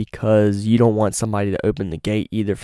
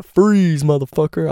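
higher, louder mock cop command; cop ordering; 7 semitones higher, 4 dB louder, slower than usual. Cop telling him to freeze, commanding. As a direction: imitate a cop yelling at a suspect. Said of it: "like a cop was telling me to".

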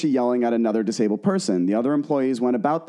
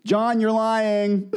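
laughing; 11 semitones higher, much slower than usual. Retold or imitated. Reading owner's reply, laughing.

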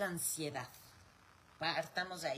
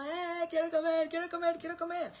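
put-on much higher and louder, a wailing baby; crying newborn; 10 semitones higher, 7 dB louder than usual. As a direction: whine it out like a hungry newborn crying for milk. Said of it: "Entonces está llorando".

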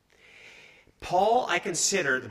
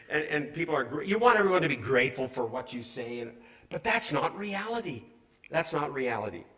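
ordinary delivery

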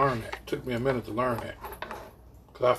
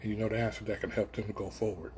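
normal talking voice

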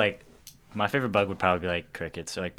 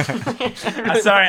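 laughing; 10 semitones higher, much slower than usual. Real, not acted. Cracks up mid-answer.

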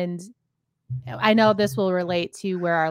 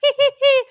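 very high, loud hee-hee; fake giggle; 9 dB louder, faster than usual. Woman imitating her third-grade friend trying new laughs, high fake giggle. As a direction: imitate a child practising a squealy new laugh. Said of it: "She was trying out new laughs".

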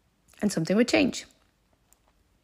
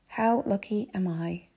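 slow savouring; 3 dB quieter, slower than usual. Retold or imitated. Traveller reminding herself of her luck, slowly savouring. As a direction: let each word sink in.

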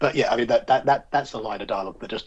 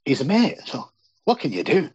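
put-on higher-pitched, light Scottish accent; slightly Scottish accent; 4 semitones higher than usual. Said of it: "slightly Scottish accent".

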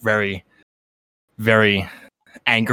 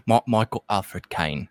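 Michael Caine impression; 4 semitones lower, 6 dB quieter, slower than usual. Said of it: "That was, uh, not great".

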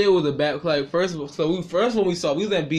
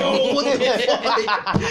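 drawn-out disbelief; 5 semitones higher, much slower than usual. Retold or imitated. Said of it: "I was like".

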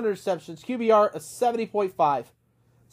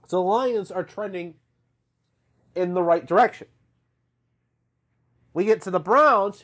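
normal talking voice

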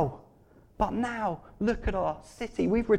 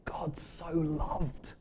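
very slow, soft dwelling on words; hushed wonder; 7 dB quieter, much slower than usual. Real, not acted. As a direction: linger softly over each word.

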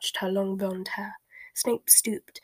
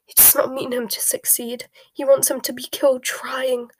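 anguished outburst, building louder and higher; choked up; 4 semitones higher, 6 dB louder than usual. A narrator's reading. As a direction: cry out a mother's despair. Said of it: "choked Narcissa".

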